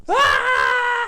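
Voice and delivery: sudden high yelp of pain, loud; shocked child's yelp; scream of pain. Retold or imitated. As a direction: yelp as the hot water hits. Feet splashed with boiling water.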